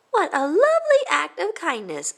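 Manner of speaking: high, sing-song praise; praising coach. Acted. Coach praising Jessica in gymnastics class. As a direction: gush over the kind act.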